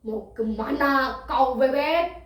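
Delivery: slow, gloating villain with big laughs; menacing crocodile. Acted. Crocodile corners the duck to eat it, slow menace. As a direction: taunt the prey slowly, laughing between threats. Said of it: "Begitu kata buaya".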